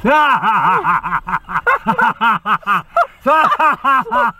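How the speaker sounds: high, rolling laugh; hearty laughter. Real, not acted. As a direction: laugh long at your own flop. Laughs at own failed joke.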